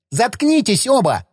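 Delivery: a voice not his own, shrieking at the quarrelling pair; furious yell. A narrator's reading. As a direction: scream them both into silence. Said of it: "заорал вдруг не своим голосом".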